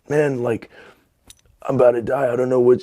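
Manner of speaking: high, strained plea, acted out; struggling to speak. Telling his roommate he's about to die, struggling to speak. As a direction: imitate someone barely able to get words out. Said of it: "I couldn't speak".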